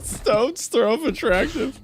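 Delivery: slow laughing disbelief; laughing repeat. Real, not acted. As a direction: repeat it through laughter, slowly.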